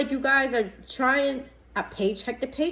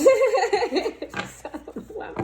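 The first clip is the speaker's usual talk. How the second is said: high-pitched burst of laughter; embarrassed laugh at herself; 10 semitones higher than usual. Real, not acted. Laughing at own yelling.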